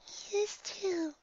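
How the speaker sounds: high, soft, drawn-out comeback; little pony retort. A narrator's reading. Shy pony answering back in snowball fight, small retort. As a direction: retort in a tiny sing-song squeak. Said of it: "she returns".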